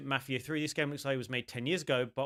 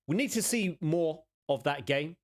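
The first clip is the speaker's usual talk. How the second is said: normal talking voice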